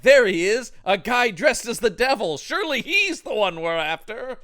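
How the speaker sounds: high, loud mock-triumphant cop; mock eager cop. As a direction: proudly announce the obvious culprit. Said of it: "I just love the idea of".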